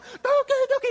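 very high, loud calls; excited calling. Retold or imitated. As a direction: call out in excitement. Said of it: "we're all going".

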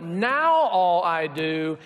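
long, slow swoop up on "now"; mock-dreary, dragged-out refrain; drawn-out lament. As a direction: stretch every word, sliding down after "now". Weary married refrain, drawn out.

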